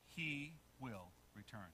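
slow, low and soft; quiet solemn statement. Shown as a demonstration. Preacher giving the congregation a key line, quiet and slow. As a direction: say it softly, word by word. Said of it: "A little bit louder".

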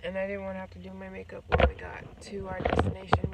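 usual speaking voice

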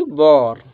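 "बॉर" with a long rounded aw; corrected pronunciation. Shown as a demonstration. Teacher corrects students' reading, "बॉर" with long aw. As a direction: sound out "बॉर" with a drawn rounded vowel. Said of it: "इसकी ध्वनि क्या निकलेगी?".

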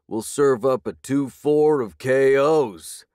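high, loud, slow thug taunt; mocking thug. A narrator's reading. Thug threatens the beaten boyfriend, loud and gloating. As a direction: crow the threat over the victim. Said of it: "another thug laughed".

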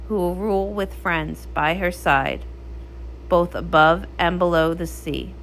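lowering, measured fairy-tale telling; once-upon-a-time narrator voice; storybook narration. Acted. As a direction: tell it like a legend by firelight.

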